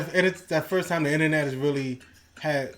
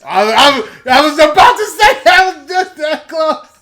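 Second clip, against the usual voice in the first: excited; 13 semitones higher, 16 dB louder than usual. Real, not acted. Excited near-miss guess.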